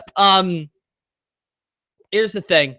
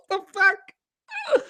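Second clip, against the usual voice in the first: high, slow words through laughter; laughing disbelief; 8 semitones higher, 6 dB quieter, much slower than usual. Real, not acted. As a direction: wheeze it out while laughing, softer. Laughing at a viewer's comment.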